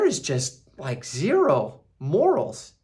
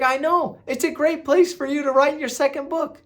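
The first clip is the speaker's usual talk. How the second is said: higher and louder, imitating an eager husband; mock eager husband; 12 semitones higher, 4 dB louder than usual. Husband pitches the country house, mock eager. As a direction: sell the house to her with mock enthusiasm. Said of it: "He's like".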